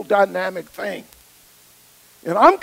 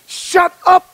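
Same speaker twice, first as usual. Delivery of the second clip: loud, much higher "Shut up"; commanding rebuke; 9 semitones higher, 9 dB louder than usual. Retold or imitated. Jesus silencing the demons, a loud high command. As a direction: slam the words down with full authority.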